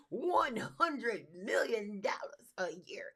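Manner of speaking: slow, stretched-out mock amazement; mock grandiose. Host mocks an inflated revenue answer, drawn-out mock grandeur. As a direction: draw out each word in hammed-up awe.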